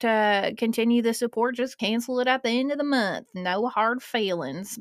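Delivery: normal talking voice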